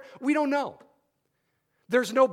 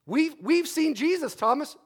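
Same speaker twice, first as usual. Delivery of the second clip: higher, eager announcement; cheerful nagging, pitched up; eager insisting; 6 semitones higher than usual. Retold or imitated. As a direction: disciples pestering Thomas with good news. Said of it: "you could imagine what this is like for a week".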